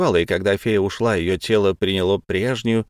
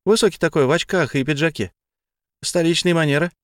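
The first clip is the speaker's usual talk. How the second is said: Tamara voice; 6 semitones higher than usual. A narrator's reading. Tamara describes Victor.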